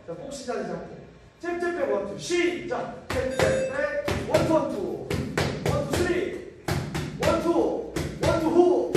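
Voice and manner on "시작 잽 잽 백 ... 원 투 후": high, loud shouted gym commands; barking gym coach. Real, not acted. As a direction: bark each punch like a coach. Coach calling punch combos.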